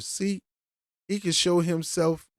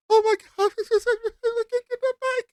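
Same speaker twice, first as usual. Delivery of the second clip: much higher, whiny mock Karen voice; slower than usual. Commentator mocks the bike woman to viewers, high whiny parody. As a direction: squeal it like a spoiled woman who wants the bike. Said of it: "Girl, if you don't stop with those crocodile tears".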